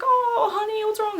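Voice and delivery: high-pitched imitation of cooing concern; mock motherly teacher voice; sympathetic teacher. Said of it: "And she's like".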